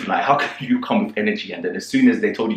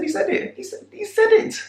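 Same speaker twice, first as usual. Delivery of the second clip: high repeated insistence; insisting. Retold or imitated. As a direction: voice climbing much higher on each repeat. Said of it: "I said".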